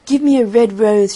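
loud, lightly acted plea; crying out. A narrator's reading. Nightingale asks the third tree, controlled cry. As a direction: call out to be heard, still controlled. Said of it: "she cried".

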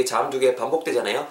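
ordinary talk, nothing marked

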